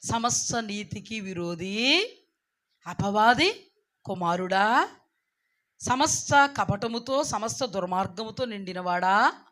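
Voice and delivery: slow, drawn-out accusation; solemn denunciation with stretched endings; measured scripture rebuke. Acted. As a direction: recite each charge deliberately. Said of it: "అందుకే ఇక్కడ ఏమన్నాడు?".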